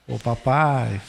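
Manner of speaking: put-on child wheedling a father for something. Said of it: "aí fica fazendo assim".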